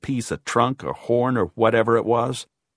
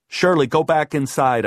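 raised, louder command; firm order; 5 semitones higher, 5 dB louder than usual. A narrator's reading. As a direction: send her off with authority. Narrator orders Shirley inside.

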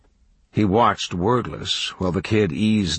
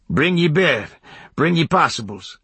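high, raised rustic voice; country old-timer's instructions; hermit inviting; 8 semitones higher than usual. A narrator's reading. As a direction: usher the kid in like a backwoods hermit. Hermit invites kid inside.

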